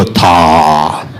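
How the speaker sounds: long, low, held THA; low end. Shown as a demonstration. Percussion teacher showing the class the low end, long low "tha". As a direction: draw "tha" out slowly on a low note. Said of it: "That's a low end".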